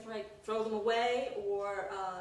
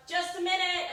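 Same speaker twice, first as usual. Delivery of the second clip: high, loud hurried call; 9 semitones higher, 7 dB louder than usual. Retold or imitated. To the impatient crowd outside, a high loud flustered call. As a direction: call out through the door, flustered. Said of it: "And I'm like".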